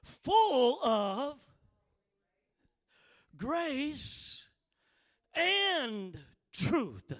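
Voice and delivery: each key word held, long pauses between; drawn-out preacher's emphasis; dramatic scripture proclamation. Acted. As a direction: let each word land on the congregation. Draws out the verse's climax.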